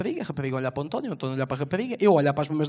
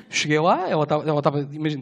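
ordinary talk

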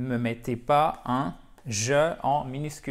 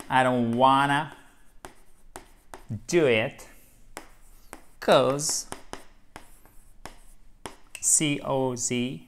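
slow spelled-out dictation; slower than usual. Shown as a demonstration. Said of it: "double N".

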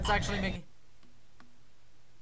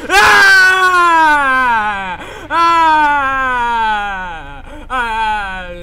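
high, loud panicked screaming; loud shrieks of horror; terrified screams; 6 semitones higher, 17 dB louder, much slower than usual. Real, not acted. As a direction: scream in real fright. Screams at Slender Man.

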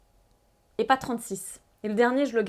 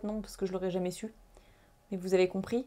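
usual speaking voice

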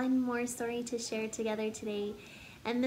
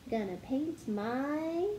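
bouncy storybook narration building up the list; story reading; 3 semitones higher than usual. Acted. Teacher reading a silly picture book to kids, bouncy storytelling. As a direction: read it like a picture book to children. Sings-songy refrain, teasing guesses.

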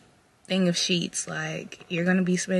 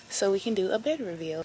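usual speaking voice

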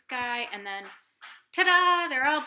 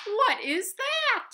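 surprised gasp; 13 semitones higher, 6 dB louder, slower than usual. Acted. Narrator startled by noise.

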